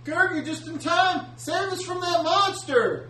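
high, slow pleading; frightened villager. Acted. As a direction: beg the hero for help. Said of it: "somebody comes up to".

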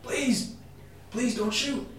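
beg, then lose your voice; frightened man whimpering at the soldiers, fading; fading plea. Acted. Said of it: "the man is going".

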